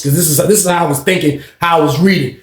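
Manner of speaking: usual speaking voice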